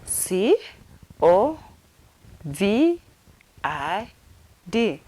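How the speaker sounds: letter by letter with pauses; spelled out. Shown as a demonstration. Teacher finger-spelling covid for learners, spelled out. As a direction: spell it slowly, each letter on its own. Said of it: "How do you finger spell it?".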